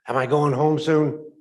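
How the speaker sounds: calling out. Acted. Patient calls across room to the medic, loud. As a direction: call out eagerly to the doc.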